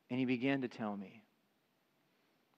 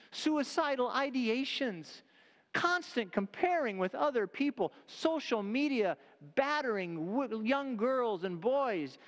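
preaching at full force; 9 semitones higher than usual. Acted. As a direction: preach it to the back pew. Preacher's raised climax.

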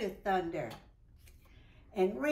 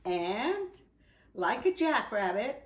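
ordinary delivery, nothing marked

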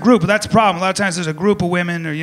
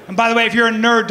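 normal talking voice

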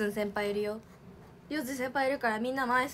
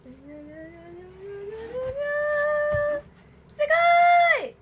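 long, drawn-out high squeal, much louder; excited squeal; 11 semitones higher, 7 dB louder, much slower than usual. Real, not acted. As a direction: stretch the wow out in delight. First look at the panel.

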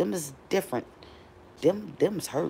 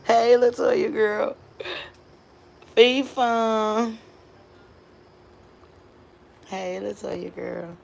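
sing-song call; 6 semitones higher, much slower than usual. Real, not acted. Sing-song calling to friend.

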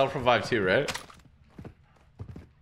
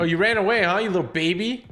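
mocking taunt; 7 semitones higher, 3 dB louder than usual. Taunting a fleeing enemy player.

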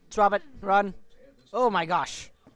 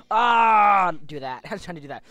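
normal talking voice

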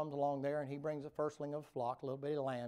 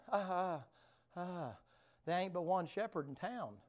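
dismayed Cain; 5 semitones higher than usual. Acted.

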